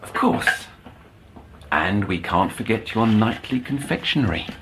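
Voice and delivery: normal talking voice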